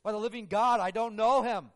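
loud, high desperate denial; swearing oath. Acted. Peter denying Jesus, loud swearing oath. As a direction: shout the oath like a cornered man. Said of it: "with cursing and swearing, made oaths".

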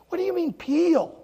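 high, loud, incredulous; baffled question. Retold or imitated. Pastor questioning kids about peeling, baffled. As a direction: ask it loudly, totally lost.

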